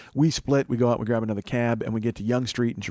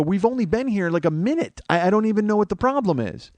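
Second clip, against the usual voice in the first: high-pitched defensive complaint; exasperated protest; 9 semitones higher, 3 dB louder than usual. Retold or imitated. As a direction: act out pleading bafflement in an argument. Protesting in retold quarrel.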